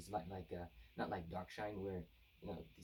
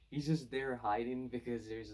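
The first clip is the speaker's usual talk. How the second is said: chuckling through the words, a bit higher and louder; laughing; 4 semitones higher, 7 dB louder than usual. Real, not acted. Reviewer laughing at the hiding hero, laughing. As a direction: laugh it out while explaining.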